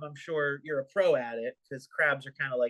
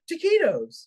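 high, louder mock enthusiasm; mimicking co-host; 11 semitones higher, 5 dB louder, slower than usual. Teasing friend about his random food pick, high mock excitement. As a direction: tease with an excited one-word outburst. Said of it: "you're just like".

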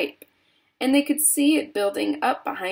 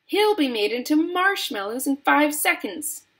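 high, indignant outburst; raised, fretful snapping; snapped protest; 7 semitones higher than usual. A narrator's reading. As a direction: protest as an upset mother. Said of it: "snapped Missus Gloop".

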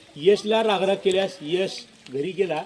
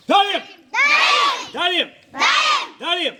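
said loudly; 10 semitones higher, 5 dB louder than usual. Shown as a demonstration. Teacher shouting डाळिंब for the class to repeat. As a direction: shout डाळिंब high and loud, each time. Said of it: "एवढं जोरात म्हणले".

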